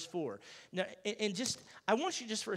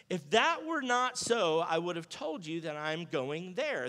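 scripture reading; 4 dB louder, slower than usual. Retold or imitated. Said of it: "he said".

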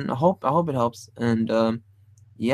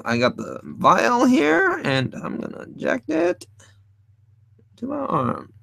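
mad doctor; 9 semitones higher than usual. Acted. Voicing drawn doctor character.

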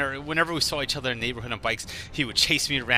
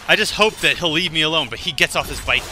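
usual speaking voice

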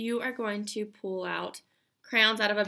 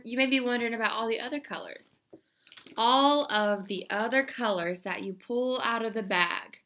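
ordinary delivery

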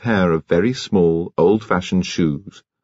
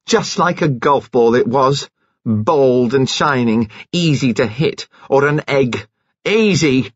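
higher, slow, gloating fury; mild acted rage; 5 semitones higher than usual. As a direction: savour each word of the accusation in rage. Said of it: "She was beside herself with rage".

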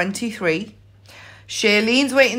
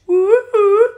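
high loud woo-hoo; excited cheer; 8 semitones higher, 6 dB louder than usual. Real, not acted. Cheering a near win.

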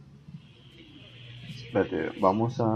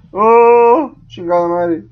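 high loud alarmed yells; startled shouting; 11 semitones higher, 8 dB louder than usual. Real, not acted. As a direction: shout after a scare. Jump scare in game.

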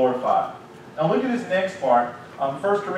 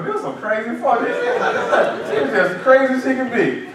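laughing kids mock; 8 semitones higher, 4 dB louder than usual. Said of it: "they sit in the room and go".

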